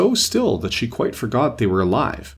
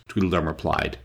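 ordinary delivery

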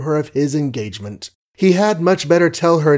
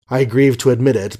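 ordinary delivery

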